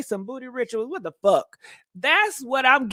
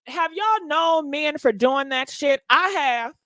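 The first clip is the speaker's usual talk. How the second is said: normal talking voice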